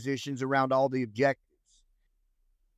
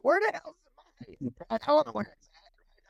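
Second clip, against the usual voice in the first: much higher, exaggerated clueless disbelief; mock bewilderment; 7 semitones higher than usual. To his Ranger buddies, hammed-up high baffled voice. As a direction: act baffled, voice jumping up high.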